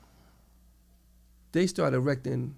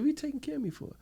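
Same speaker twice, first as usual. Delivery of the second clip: high, softer puzzled retort; puzzled question; 13 semitones higher, 4 dB quieter, faster than usual. Retold or imitated. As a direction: re-enact a baffled question, pitched way up. Retells his puzzled question to officers.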